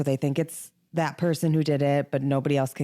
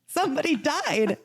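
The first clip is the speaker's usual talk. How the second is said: much higher, giggling through it; laughing; 11 semitones higher than usual. Real, not acted. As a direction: still laughing at the memory. Laughing after retold reaction.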